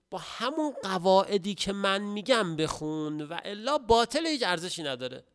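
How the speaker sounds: emphatic warning. Acted. God's command, rising to emphatic.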